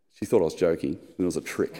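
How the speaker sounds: ordinary talk, nothing marked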